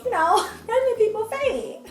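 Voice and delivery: high, laughing shrug. Real, not acted. Brushing off her fainting to her viewers, laughing. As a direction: laugh it off lightly.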